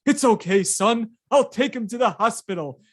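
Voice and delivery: hammed-up reassuring hero; lightly voiced Babe Ruth soothing the kid; Babe Ruth comforting. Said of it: "He's like".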